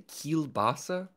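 kielbasa repeated high and slow; mocking repeat. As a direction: echo kielbasa back in disbelief. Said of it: "What the fuck did you just say".

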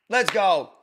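usual speaking voice

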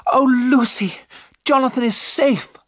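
high, loud cry of relief; excited cry. A narrator's reading. Mina tells Lucy that Jonathan is safe, excited cry. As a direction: burst out with joyful news, pitched right up. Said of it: "Mina cried".

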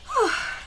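soft, high breathed-out sigh; sigh of relief. A narrator's reading. Blossom safe back in her chamber, relieved sigh. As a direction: let out a short relieved sigh. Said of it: "breathed a sigh of relief".

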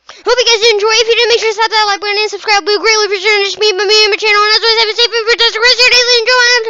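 fast, high, upbeat sign-off; YouTuber outro. Acted. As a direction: rattle off the outro with energy.

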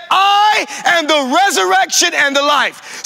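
high, loud proclaiming shout; preaching shout. Acted. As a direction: cry it out to the whole church. Preacher shouting Jesus' words.

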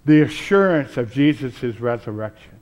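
ordinary delivery

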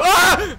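short high shriek; jump-scare yelp; startled scream. Real, not acted. Freddy suddenly there.

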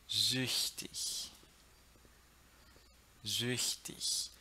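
"Sprechen", "Mädchen", "Süchtig" with ch as the soft hiss of ich; soft German ch demo. Shown as a demonstration. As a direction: enunciate "Köchin" and "Lächeln" as isolated model words, soft airy ch. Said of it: "vamos ver outros exemplos". ("Sprechen" com o ch suave de ich, bem articulado)

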